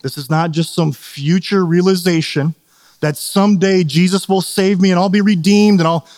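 ordinary talk

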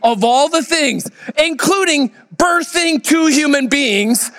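high, slow, gleeful exclaim; imitating a delighted wife, pitch way up; elated. Wife gloating over the labor simulator, elated and high. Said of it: "is just elated".

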